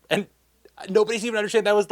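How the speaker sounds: ordinary talk, nothing marked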